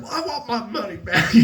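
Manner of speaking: high-pitched mock whine through a laugh; laughing imitation. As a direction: laugh while aping his demand. Quoting the friend, laughing.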